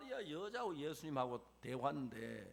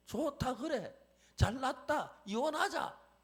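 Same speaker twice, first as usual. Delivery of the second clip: higher and louder, fed up; angry wife; 7 semitones higher, 4 dB louder, slower than usual. Acted. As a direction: fling back a furious retort. Wife quarrelling with husband. (남편에게 맞서는 아내, 높고 크게 화난 목소리)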